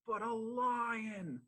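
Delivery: big, high, drawn-out storyteller's reveal; dramatic reveal. Acted. Storyteller springs the surprise on young listeners, dramatic reveal. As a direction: stretch it out high and loud.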